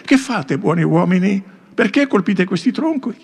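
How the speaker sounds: put-on high, innocent boy's voice; disguised nymph as a shepherd boy questioning woodcutters; young shepherd boy. A narrator's reading. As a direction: ask like a curious young shepherd. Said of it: "l'aspetto di un pastorello".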